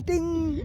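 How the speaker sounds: high sung "ding" like a bell chime. Pretend. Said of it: "throw a ding in".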